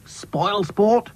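high, scornful snap; snapped taunt. A narrator's reading. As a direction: snap it at the sulker. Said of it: "snapped Dotty again".

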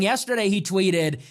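normal talking voice

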